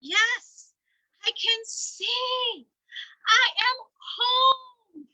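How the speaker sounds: high, loud, slow and amazed; high, loud, slow triumphant cry; joyful bear voice. Acted. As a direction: marvel at finally being home.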